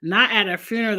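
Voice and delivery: ordinary talk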